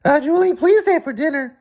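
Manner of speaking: put-on very high squeaky boy voice; squeaky David voice. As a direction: squeak it out like a cartoon kid. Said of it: "David said".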